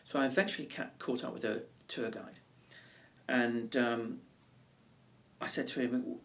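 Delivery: ordinary talk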